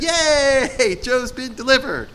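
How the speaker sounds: high mock celebration; mock cheer. Imitating fickle friends greeting Job, mock cheer. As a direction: squeal it like a fair weather friend. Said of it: "came and said".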